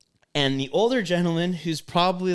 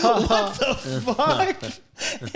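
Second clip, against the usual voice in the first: slow, drawn-out, higher-pitched disbelief; incredulous; 5 semitones higher, much slower than usual. Retold or imitated. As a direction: stretch each word in mock astonishment. Retells his disbelief at the stranger.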